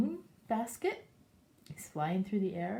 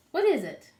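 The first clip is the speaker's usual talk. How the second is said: gasp, then high loud reveal; gasping excitement; 10 dB louder than usual. Real, not acted. Dramatic reveal for children.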